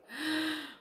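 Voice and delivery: quick high startled gasp; a high shocked intake of breath; sharp gasp. Retold or imitated. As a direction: gasp as if caught off guard. Reacting to remembering the past.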